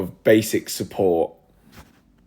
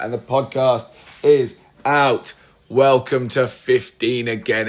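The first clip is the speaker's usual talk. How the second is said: slow, loud, raised launch call; triumphant, drawn-out welcome; excited announcement; 5 semitones higher, 6 dB louder, slower than usual. Real, not acted. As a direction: announce it big, stretching each word. Announces the podcast going live.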